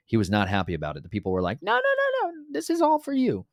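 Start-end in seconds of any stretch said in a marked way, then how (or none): 1.6-2.3 very high, squeaky mock protest; hammed-up falsetto protest; protesting people. 2.5-3.5 very high, squeaky mock plea; hammed-up falsetto insisting; whiny insistence. People insisting to God.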